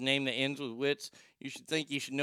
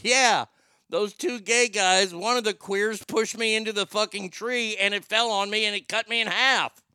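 higher, louder mock-indignant storytelling; hammed-up retort; 6 semitones higher, 4 dB louder than usual. Patient snapping back at hospital staff about the tree, hammed-up indignant. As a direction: ham it up like an injured man defending himself. Acting out his own retort.

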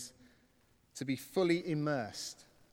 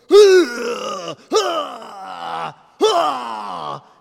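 long, loud mock wails, each falling away; hammed-up drawn-out cries of collapse; imitated loud cries; 7 semitones higher, 15 dB louder than usual. Imitating mate grabbing his chest on the floor, loud cries.

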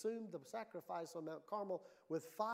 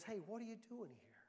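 still small voice; 3 semitones higher, 7 dB quieter than usual. Retold or imitated. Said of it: "A still small voice that says".

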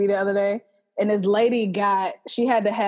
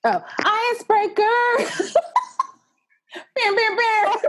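excited squeal; 13 semitones higher, slower than usual. Real, not acted. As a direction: squeal it out, delighted. Excited sudden remembering.

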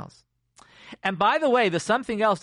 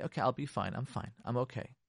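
softer, lower, as if settling yourself; calm yourself down under your breath; quiet self-reassurance; 4 semitones lower, 8 dB quieter than usual. Acted. Self-soothing inner talk, acted.

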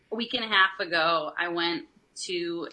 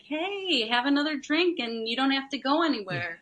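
mock sweet coaxing; 7 semitones higher than usual. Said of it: "He thinks that I'm gonna be like".

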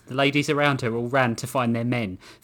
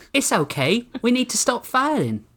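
high-pitched woman's character voice; woman pleading; 11 semitones higher than usual. A narrator's reading. Samantha calming the defenders in the saloon, high pleading voice. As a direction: raise the pitch and urge them to hold fire.

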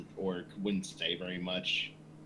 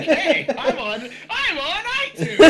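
laughing excited quote; 11 dB louder than usual. Retold or imitated. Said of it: "I'm like".

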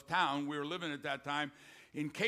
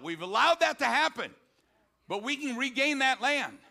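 louder, higher preacher's call to the flock; fiery preaching; 3 semitones higher, 4 dB louder than usual. Acted. Preacher urging the congregation to stand for God, fiery preaching. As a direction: preach it out with full force.

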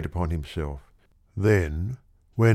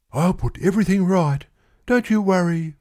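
higher, firm comforting promise; young man calming the girl; reassuring; 11 semitones higher, 3 dB louder than usual. Acted. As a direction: promise to fix it.

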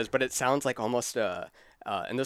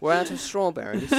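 higher, elegant British line; 5 semitones higher than usual. Shown as a demonstration. Host tries word in sentence, elegant British accent. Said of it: "like, more elegant".

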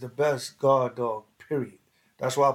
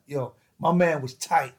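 normal talking voice

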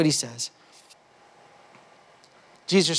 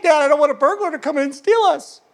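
lightly voiced scared little boy, a bit higher; child's voice; 8 semitones higher than usual. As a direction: play the frightened son asking dad. Son voiced in retold story.

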